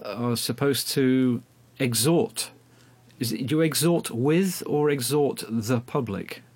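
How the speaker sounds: normal talking voice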